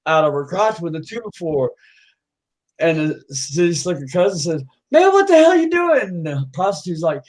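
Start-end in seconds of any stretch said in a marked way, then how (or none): 4.9-6.0 high, loud shout of disbelief; alarmed yell. Acted. Cousin bursting in on the hillbilly, alarmed yell. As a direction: yell it as a shocked bystander.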